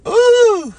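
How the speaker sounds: very high loud whooping ooh; excited ooh. Real, not acted. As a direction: let out a big squealing ooh. Excited cry on stream.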